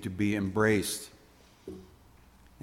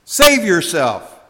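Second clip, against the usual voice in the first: higher, louder, slow scornful cry; deriding taunt; 7 semitones higher, 13 dB louder, slower than usual. Acted. Criminal deriding Jesus from his cross, loud scorn. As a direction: spit it up at him in scorn. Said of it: "the criminal derided".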